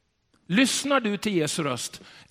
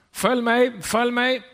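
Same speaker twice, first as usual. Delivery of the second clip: high, loud call to the flock; shepherd calling out; 9 semitones higher, 4 dB louder, slower than usual. Retold or imitated. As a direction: call the sheep to follow. (herden ropar på fåren)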